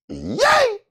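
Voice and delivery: high squealed yay; shrill happy whoop; excited cheer. Real, not acted. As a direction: cheer your own comeback. Cheering on his return.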